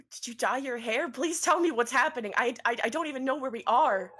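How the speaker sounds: high, shaky pleading questions. Acted. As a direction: beg for answers.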